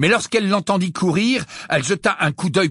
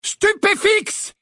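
shouted spell; much slower than usual. A narrator's reading. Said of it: "cria Harry".

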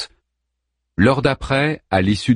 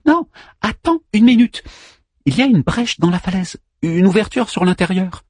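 high figure voice, interrupting; Manmut's urgent discovery; 10 semitones higher than usual. A narrator's reading. Manmut spots a gap in the cliff, high and urgent. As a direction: break in with a raised, urgent voice.

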